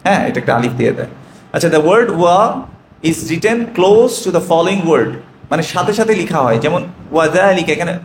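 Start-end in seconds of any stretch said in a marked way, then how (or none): 1.7-5.1 slow, careful reading aloud; dictating slowly to students. Real, not acted. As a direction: read the rule out word by word, slowly. Reading English rule slowly.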